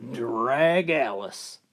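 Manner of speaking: slow, heavy drag Alice; weary drawn-out punchline. Acted.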